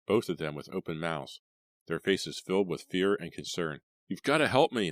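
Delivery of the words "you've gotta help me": high loud plea; screaming. A narrator's reading. Leah begs her parents, panicked scream. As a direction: beg them in panic. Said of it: "Leah screamed".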